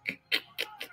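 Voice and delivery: very high, breathy squeal of laughter; a real giggle that cracks up high; cracking up at a chat message; squeaky laugh. Real, not acted. Reading chat, bursts out.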